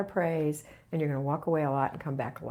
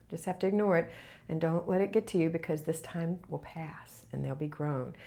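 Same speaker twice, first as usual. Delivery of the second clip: ordinary talk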